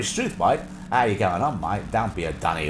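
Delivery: put-on broad old-soap Australian; mock Australian chat; Australian accent. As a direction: imitate early Australian soap slang. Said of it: "it was very".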